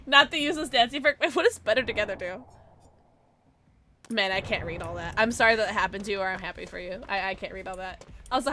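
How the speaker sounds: ordinary talk, nothing marked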